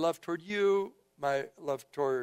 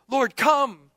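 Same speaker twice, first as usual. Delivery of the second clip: raised, loud cry for help; urgent plea; 8 semitones higher, 9 dB louder than usual. Acted. Man begging Jesus for his dying child, urgent plea. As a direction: plead urgently, calling out higher and louder.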